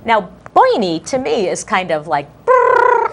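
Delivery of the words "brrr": high, loud comic shiver noise; a cartoon shiver of a sound; mock brrr. Co-host sums up Bernie with a comic shiver sound.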